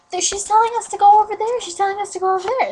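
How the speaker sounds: put-on high, loud, rapid-fire child; fast, high mock-child urging; imitated urgent kids. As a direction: rush it out like an excited kid. Kids urge mom toward the grave.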